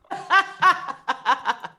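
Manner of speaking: helpless laugh after a bad roll; big, high-starting laugh; laughing at failure. Real, not acted.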